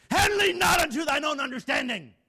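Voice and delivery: high, very loud preacher's yell; sudden yell. Shown as a demonstration. Preacher startles the congregation mid-verse, sudden high yell. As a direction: bellow the verse to shock the room. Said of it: "yelling like that up here".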